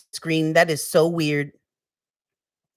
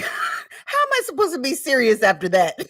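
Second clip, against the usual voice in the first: higher voice bubbling with laughter; laughing in disbelief; 5 semitones higher than usual. Real, not acted. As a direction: ask it while cracking up. Cannot stop laughing.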